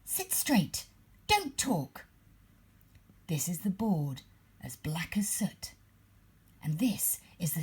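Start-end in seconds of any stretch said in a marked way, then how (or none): 0.1-2.1 high, strict classroom order; teacher barking orders at the class, high; stern teacher. A narrator's reading. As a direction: snap the class into line. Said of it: "telling the class".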